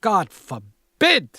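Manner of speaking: slow, high, loud wail; mother wails at her son's talk of death; crying out. A narrator's reading. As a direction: cry it out to the heavens. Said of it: "she cries".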